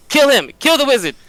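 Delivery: high-pitched urgent goading cry; egging on. Acted. Penny backing out while inciting the goblins, high urgent cry. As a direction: call it out to stir up a mob. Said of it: "back up out of the room and be like".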